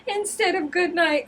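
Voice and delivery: high laughing voice; giggling through it. Real, not acted. Laughing at the joke.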